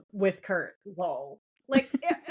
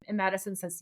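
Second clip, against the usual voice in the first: normal talking voice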